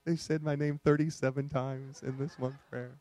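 put-on an indulgent father cooing over a child; a lightly voiced doting God; God, amused. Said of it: "He's like".

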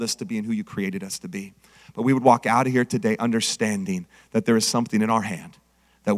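low, soft closing prayer. Acted. Pastor closing in prayer, low and hushed. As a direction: pray over the congregation quietly.

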